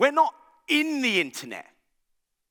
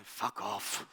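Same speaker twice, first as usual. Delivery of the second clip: muttered dismissal; 11 semitones lower, 11 dB quieter than usual. Retold or imitated. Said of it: "they're like".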